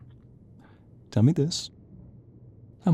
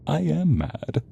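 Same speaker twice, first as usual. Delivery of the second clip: ordinary talk, nothing marked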